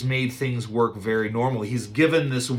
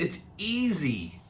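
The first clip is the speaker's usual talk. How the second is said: slow, a bit higher; light insistence; 5 semitones higher, 7 dB quieter, slower than usual. Shown as a demonstration. Servant coaxing Naaman, slow and light. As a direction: press it gently.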